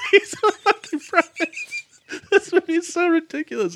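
cracking up in disbelief; laughing. Real, not acted. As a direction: laugh through the words at the absurd movie. Laughing at absurd war movie.

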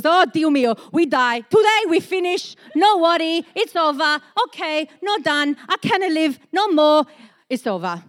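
put-on light Italian accent, dramatic lament; Italian uncles. Italian uncles lament the storm in a light Italian accent. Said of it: "I could just imagine my uncles".

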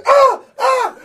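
loud high yelp of pain; pained cry. Retold or imitated. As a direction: yelp like your back just gave out. Teacher crying out in pain.

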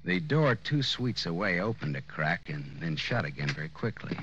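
low, unhurried private-eye voice-over; hard-boiled narration. A narrator's reading. Detective narrating his night on the Strip, in hard-boiled voice-over. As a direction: set the night scene like a tired detective.

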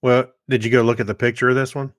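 ordinary delivery

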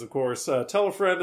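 ordinary delivery

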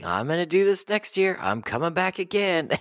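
put-on high-pitched, insistent kid; Linus. Retelling the lonely believer vowing to return, high mock-kid voice. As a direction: pitch it way up, stubbornly hopeful. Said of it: "And Linus is like".

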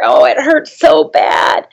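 slow, high, tearful cry; crying out in pain. Retold or imitated. Recalling the bloody thumb, a slow tearful cry. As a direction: moan it like it still hurts. Retelling the painful injury.

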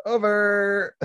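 high, slow sing-out; drawn-out knockout call. Pretend. Host calling the short fight's end, drawn-out. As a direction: stretch it out to the finish.

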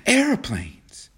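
AIRPLANES announced high, falling at the end; excited, high-pitched correction; excited exclamation. A narrator's reading. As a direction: burst out with the news in high excitement. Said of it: "she interrupted excitedly".

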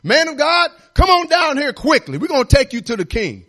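king's captain talking real harsh to the man of God; harsh summons. Acted. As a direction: bark it at the prophet like a soldier. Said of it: "talking real harsh to the man of God".

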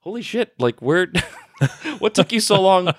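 high, laughing disbelief; amazed friends. Retold or imitated. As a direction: toss it out amazed through laughter. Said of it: "they were like".

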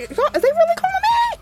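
rising way up in mock disbelief; incredulous high rise. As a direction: climb high in shocked disbelief. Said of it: "I was like".

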